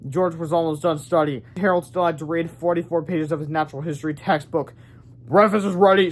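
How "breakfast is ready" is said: shouted. A narrator's reading. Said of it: "shouted George's dad".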